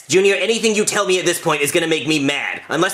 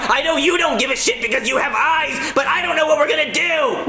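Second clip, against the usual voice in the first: high frustrated rant; 8 semitones higher than usual. Acted. Ranting about the shrunk blueprints.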